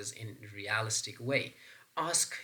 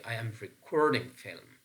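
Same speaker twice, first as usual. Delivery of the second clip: "recording" as re-CORD-ing; stress on second syllable; 3 dB louder than usual. Shown as a demonstration. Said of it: "See, curd is in bold".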